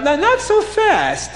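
high and slow, holding him back; mock sly insistence; stopping him. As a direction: stretch it out high, wagging a finger. Officer stops the chassid leaving.

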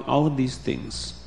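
plain reading voice

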